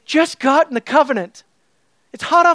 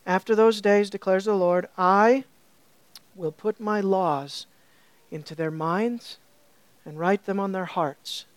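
low, much softer reading of the verses; hushed scripture reading; 5 semitones lower, 7 dB quieter than usual. Acted. Preacher reading Jeremiah aloud, hushed and reverent. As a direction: read the Lord's words as if set apart.

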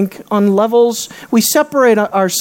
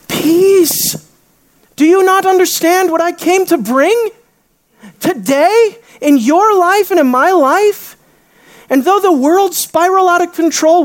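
commanding peace; 8 semitones higher than usual. Retold or imitated. Jesus speaking peace to the disciples, raised. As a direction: declare peace over the storm.

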